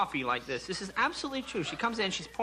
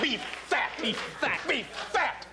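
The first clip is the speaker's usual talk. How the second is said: mock incredulous repetition; 8 semitones higher, much slower than usual. Mocking repetition of beef fat.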